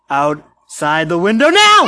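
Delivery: loud scary jump-scare; sudden shout. A narrator's reading. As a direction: shout it to startle.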